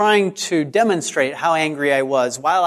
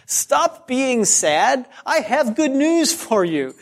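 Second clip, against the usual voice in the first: put-on higher, slow sing-song coaxing of a child; coaxing parent; 6 semitones higher than usual. Parent cheering up a sad child, higher and slow.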